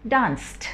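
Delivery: डांस्ड with a hard t at the end. Shown as a demonstration. Teacher showing students डांस्ड ends in a t sound. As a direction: say डांस्ड ending in a sharp t sound. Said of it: "डांसेड या डांस्ड नहीं बोल सकते हम".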